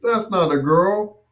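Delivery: retold little boy's complaint about a baby brother; kid complaining to mom. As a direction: whine at mom like a disappointed little boy. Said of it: "I'm like".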